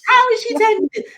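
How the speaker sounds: astonished, high and loud; amazed. Retold or imitated. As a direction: squeal it in disbelief. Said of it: "And I was like".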